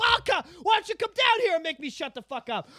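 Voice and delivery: high, shouted dare; mock challenge. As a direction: dare him like a comic brawler. Escalating acted film quarrel.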